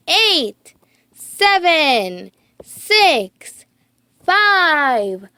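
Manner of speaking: put-on kids counting down to rocket launch, building excitement; excited countdown. A narrator's reading. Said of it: "began the countdown".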